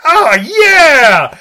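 high, loud, drawn-out mock cheer; hammed-up victory shout; triumphant whoop. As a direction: yell it like a hammed-up action hero. Acted movie hero's triumphant cheer.

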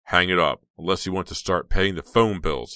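loud, irritated command; angry order. Acted. Uncle James orders Heather off the phone, loud and angry. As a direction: cut in loudly and order it.

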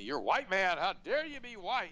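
mildly mock the outraged stranger; accuser's indignant challenge. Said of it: "Somebody once said to me".

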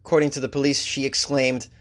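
ordinary talk

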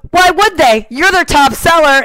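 imitating a crowing rival; retorting colleague. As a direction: fire the retort back loudly. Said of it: "then the guy goes".

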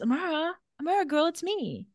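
high, soft mock-tender call; gentle coaxing. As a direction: imitate softly coaxing someone awake. Silas gently rousing revived Amara.

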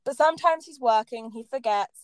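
usual speaking voice

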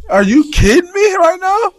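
very high, loud disbelieving cry; amazed outburst. Retold or imitated. Realizing his mom went through this, high amazed cry. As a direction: squeal it in sheer disbelief.

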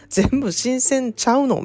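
high, slow bewildered question; drawn-out confused query; puzzled. Retold or imitated. Confused about coffee creamer.

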